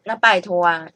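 ordinary delivery

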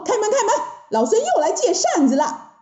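high, pushy shout at a door; insistent, high-pitched monkey hero; Monkey King calling out. Acted. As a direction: call out cheekily as the Monkey King. Wukong calls at the door.

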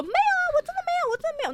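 put-on very high shrill old lady shriek, fast; grandma shrieking denial. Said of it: "我奶奶就开始暴叫". (高亢尖叫学奶奶快速否认)

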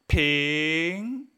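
苹 alargado, subiendo hacia arriba; segundo tono. Shown as a demonstration. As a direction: di 苹 lento con tono ascendente. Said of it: "Segundo tono hacia arriba". (segundo tono ascendente en 苹)

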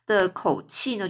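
ordinary delivery, nothing marked

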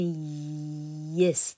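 yes with a long "eee" before the "es"; slow teaching demo of yes; stretched y glide. Shown as a demonstration. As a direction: hold the y of yes, then release it. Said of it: "你不只念了一".

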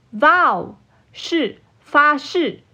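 ordinary talk, nothing marked

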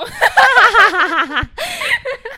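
loud falling laugh; long loud laugh. Real, not acted. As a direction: crack up. Shared laughter.

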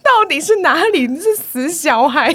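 high, loud, slow angry scold; exasperated scolding. Retold or imitated. Teacher fuming at the lying kid: slow loud scold. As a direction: drag out the fury at the brat. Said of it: "我就想说".

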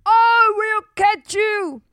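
the giant chasing Jack, shouting. Acted. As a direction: bellow the threat down the beanstalk. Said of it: "the giant shouted".

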